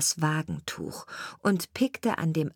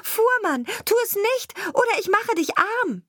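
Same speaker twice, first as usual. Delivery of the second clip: very high-pitched sparrow calling out; urgent warning cry; 4 dB louder than usual. A narrator's reading. Sparrow warning the carter off the sleeping dog, urgent high cry. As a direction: cry out a shrill threat as a tiny bird. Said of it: "Deshalb rief er".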